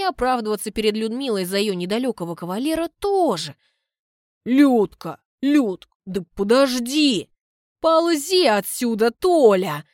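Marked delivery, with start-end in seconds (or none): 4.5-7.3 loud, slow begging call after her; a boyfriend chasing his angry girlfriend, pleading loudly; pleading suitor. Acted. Tolya pleading with angry Lyuda. 7.8-9.8 higher, louder, slow contemptuous order; a woman driving off her suitor, angry; angry snap. Acted. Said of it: "Бросает она со злостью".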